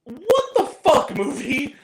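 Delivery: slow, high, loud outburst of disbelief; outraged shout. Real, not acted. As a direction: yell at the screen in disbelief. Outraged at the movie ending.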